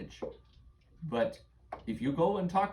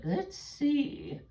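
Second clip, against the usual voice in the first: put-on high old witch reading, slow and breathy; 11 semitones higher than usual. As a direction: muse like a hag over her book. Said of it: "she's like".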